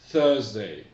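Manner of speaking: Thursday said loud, with th and stress on the first syllable; teacher insisting on Thursday; correct pronunciation. Shown as a demonstration. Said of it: "Mais c'est Thursday".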